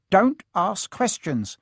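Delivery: high, drawn-out irritated order; snapping. A narrator's reading. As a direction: drop the niceness and snap. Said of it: "snapped his uncle".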